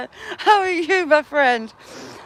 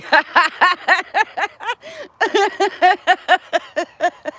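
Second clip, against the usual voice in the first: helpless snorting cackle; real snorting giggle fit; snorting laugh; 3 semitones higher, 3 dB louder than usual. Real, not acted. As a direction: laugh till you snort. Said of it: "I'm snorting".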